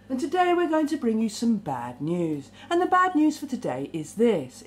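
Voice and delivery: mock bad-news bulletin, dropping at phrase ends; authoritarian newsreader. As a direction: imitate a stern newsreader, then drop the voice. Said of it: "in a very authoritarian way".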